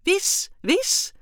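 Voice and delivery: very high, loud eager alien voice; enthusiastic. A narrator's reading. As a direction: pipe up with great enthusiasm. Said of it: "zei Wim enthousiast". (hoog en luid enthousiast)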